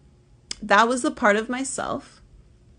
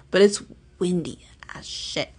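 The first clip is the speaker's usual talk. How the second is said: breathy whispered aside; whispering; 3 semitones lower than usual. Real, not acted.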